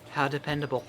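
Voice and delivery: put-on soft, low girl voice; quietly, politely as Monika. Voicing Monika.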